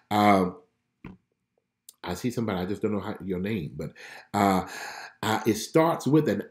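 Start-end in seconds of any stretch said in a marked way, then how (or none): none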